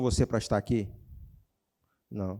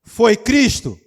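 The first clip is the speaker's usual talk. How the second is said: high, loud challenge; loud question; 8 semitones higher, 16 dB louder, much slower than usual. Real, not acted. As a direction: throw the question at the crowd. Pregador pergunta à igreja. (pergunta alta e aguda)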